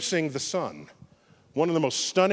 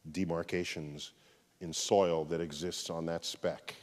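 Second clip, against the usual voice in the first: low, much softer and reverent; solemn reflection; 9 semitones lower, 6 dB quieter than usual. Real, not acted. Closing the talk on Earth as a speck, low and reverent. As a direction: let it settle like a quiet awe. Closes with pale blue dot reflection.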